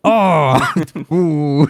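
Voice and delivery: hammed-up exasperation; fake anger. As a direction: put on a cross "oh". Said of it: "just doing the fake anger".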